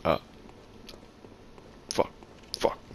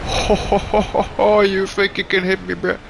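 higher, hammed-up ho ho ho; mock taunting laugh; 9 semitones higher than usual. Player taunting the boss, mock villain laugh. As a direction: gloat like a smug villain.

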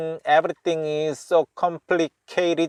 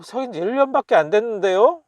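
high, quick questioning reply; pitched-up, hurried protest as in a skit; acted protesting reply; 8 semitones higher, much faster than usual. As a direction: play the other character objecting, fast with a rising end. Acting the reply in the dialogue.

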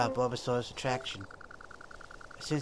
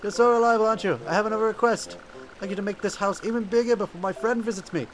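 lightly voiced Bidoof, a bit higher; cheerful little beaver asking favours; 7 semitones higher than usual. Gamer voicing Bidoof asking for more lumber.

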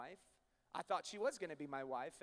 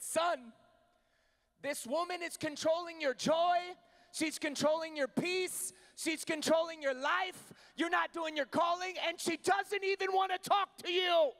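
much higher and louder, slow and building to a shout; father's impassioned rebuke; 9 semitones higher, 10 dB louder, slower than usual. Retold or imitated. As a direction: pound each line home like a father breaking through. Dad confronting son, retold.

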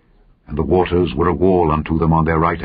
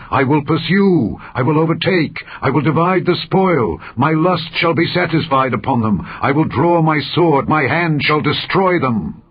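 raised, triumphant boast of a conqueror; boastful enemy; 8 semitones higher than usual. Acted. The enemy boasts of pursuing Israel, high triumphant declaration. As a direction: declaim each I will higher, gloating.

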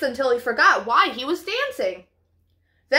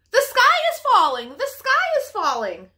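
panicked cry; 9 semitones higher than usual. Acted. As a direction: shriek the alarm like a frantic hen. Chicken Little panics about sky.

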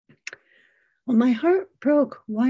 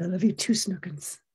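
tender reply; 5 semitones lower, 5 dB quieter than usual. Retold or imitated. Mom answering her son's I love you, tender and soft. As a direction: lower and much softer, fading to a hush.

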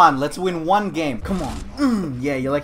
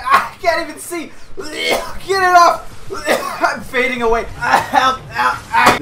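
high cry for help; hammed-up dying plea, higher pitched; mock panic; 7 semitones higher, 4 dB louder than usual. Player calling for help in game, mock panic.

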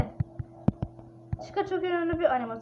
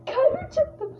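much higher, breathless cry; heart pounding; 10 semitones higher, slower than usual. Real, not acted. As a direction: squeal it after a jumpscare.

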